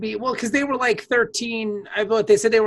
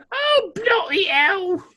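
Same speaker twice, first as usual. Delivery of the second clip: slower, drawn-out mock British; British accent; 8 semitones higher, slower than usual. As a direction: stretch it out like a weary Englishman. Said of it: "when we use an accent".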